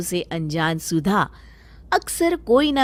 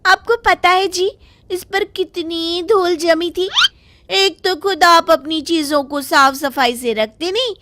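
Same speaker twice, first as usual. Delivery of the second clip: higher, aggrieved wife talking back; wife complaining; 9 semitones higher, 4 dB louder than usual. Acted. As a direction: scold him right back. (शिकायत करती पत्नी की ऊँची आवाज़)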